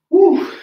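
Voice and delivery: loud high whoop. Real, not acted. Cheering after a round.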